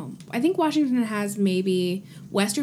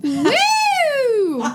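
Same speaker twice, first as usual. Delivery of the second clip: high, loud whooping cheer; party tree woo; 7 dB louder than usual. Pretend. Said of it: "you do your party tree impression".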